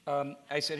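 usual speaking voice

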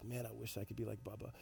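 quiet wishful aside; wistful admiring kids. Retold or imitated. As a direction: imitate kids murmuring in awe. Kids admiring Bubba in wistful awe.

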